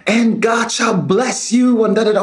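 high, drawn-out preaching cry; full-force preacher. Retold or imitated. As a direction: imitate a woman preaching with power. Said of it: "sie predigt voller Kraft".

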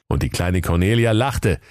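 ordinary delivery, nothing marked

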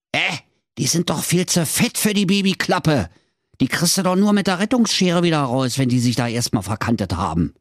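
lightly exasperated Berlin husband; objecting husband. Acted. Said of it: "sprach der Mann".

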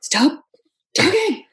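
playful mock outburst at the accent; mock exasperated. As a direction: cut them off in mock exasperation. Retort to the accents.

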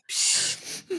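whispered shush; hushing whisper. Real, not acted. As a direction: hush the dog in a whisper. Hushing the dog.

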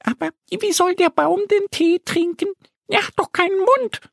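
high, puzzled little duck voice; Annie asking. Acted. Annie the duck questions Mo's tea plan, high and puzzled. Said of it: "fragt Annie".

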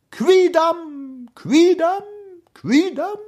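high, drawn-out echo voice; repeating echo. A narrator's reading. Echo answering the prince in Latin, high echo. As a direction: ring it back like an echo. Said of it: "sagte es".

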